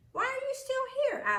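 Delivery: a school nurse caught off guard; nurse asking. Acted. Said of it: "Miss Hemphill asked".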